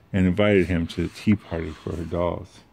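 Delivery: ordinary delivery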